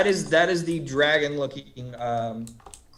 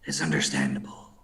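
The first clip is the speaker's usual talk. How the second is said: low, soft and fading cult voice; ominous low murmur; 9 semitones lower, 7 dB quieter than usual. Acted. Cult leader warns of straying followers, low and ominous. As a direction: murmur the threat, dropping to a whisper.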